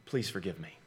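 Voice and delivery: very soft, pleading; a quiet, humble plea for forgiveness; hushed plea. Shown as a demonstration. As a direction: barely voice the request for forgiveness.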